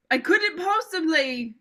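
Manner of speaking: hammed-up high posh British accent, slow; a mock upper-class British accent, drawn out; Prince Charles British accent. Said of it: "I can't do a British accent".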